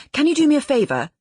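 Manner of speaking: panting hurried voicemail; out of breath. A narrator's reading. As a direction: gabble it like you ran to the phone. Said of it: "She was out of breath".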